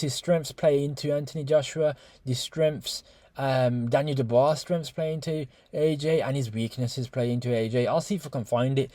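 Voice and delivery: soft, laid-back mimicry; nonchalant imitation. YouTuber imitating trainer's fight preview, nonchalant. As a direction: brush it off quietly, as the trainer would. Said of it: "non-nonchalant, got a hair gel hand in the corner".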